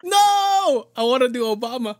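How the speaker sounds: high, loud playful refusal; loud whiny protest. Real, not acted. Host wanting to do Obama instead, loud high protest.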